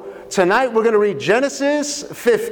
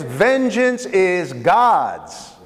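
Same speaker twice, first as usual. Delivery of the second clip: slow emphatic statement; much slower than usual. Acted. Preacher stating key point.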